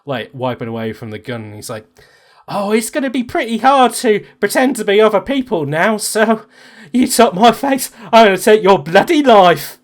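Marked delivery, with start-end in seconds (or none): 2.5-9.8 high, loud, vengeful villain; angry threat. Acted. Burned villain to the man who took his face, furious.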